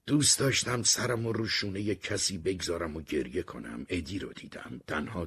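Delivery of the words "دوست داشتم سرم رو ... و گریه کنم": usual speaking voice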